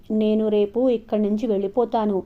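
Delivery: ordinary delivery, nothing marked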